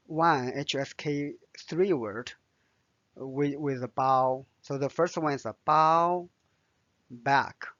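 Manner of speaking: usual speaking voice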